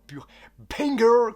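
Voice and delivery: loud, high hyped exclamation; excited. Real, not acted. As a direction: burst out with it, hyped. Hyping an awaited manga.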